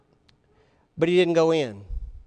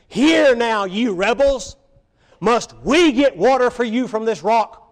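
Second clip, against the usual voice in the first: louder, higher, scolding the rebels; angry rebuke; 5 semitones higher, 6 dB louder than usual. Retold or imitated. Moses scolding the thirsty rebels, angry and loud. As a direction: Moses thundering at the grumbling crowd. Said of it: "He was so frustrated with them".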